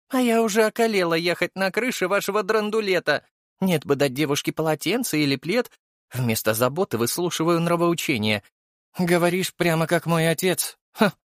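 high female character voice, huffy; miffed and high-pitched; snorting, complaining woman. A narrator's reading. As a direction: grumble high, end in a scoff. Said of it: "фыркнула она".

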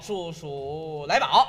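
数来宝 said slowly, syllable by syllable; slow careful 数来宝. Shown as a demonstration. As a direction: spell out 数，数来宝 deliberately.

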